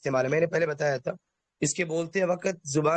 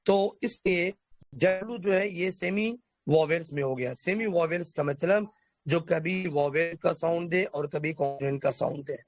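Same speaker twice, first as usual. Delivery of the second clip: ordinary delivery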